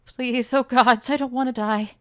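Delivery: loud, high desperate begging; terrified plea. Acted. Dying Luca begs the gods, loud terrified plea. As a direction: beg for life in terror.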